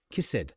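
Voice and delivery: "kissed" wrongly in two syllables, as kiss-id; extra-syllable mistake. Shown as a demonstration. Teacher imitating what many people say, "kissed" as kiss-id. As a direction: tack a mistaken id onto "kissed". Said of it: "don't pronounce these words with a".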